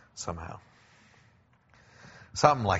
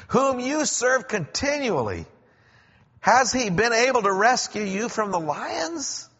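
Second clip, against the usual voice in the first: high, loud, desperate question; the king pleading down into the pit, anguished; anguished plea; 14 semitones higher, 6 dB louder than usual. A narrator's reading. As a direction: beg for an answer you fear won't come. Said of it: "in an anguished voice".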